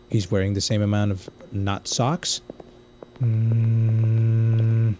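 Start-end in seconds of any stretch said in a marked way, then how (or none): 3.2-4.9 long low monotone hum; held, low buzzing hum; vibrating-phone hum. Real, not acted. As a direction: drone a steady "mm" like a buzzing phone. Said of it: "I sounded like a vibrating phone".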